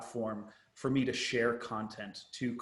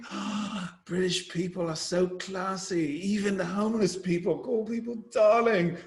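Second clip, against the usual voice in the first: naive American tourist; 7 semitones higher, 4 dB louder than usual. Retold or imitated. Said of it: "And I was like".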